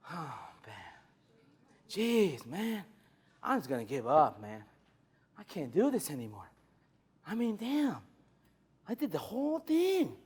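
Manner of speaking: soft, low, defeated sigh; deflated customer. Retold or imitated. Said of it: "he still says what?".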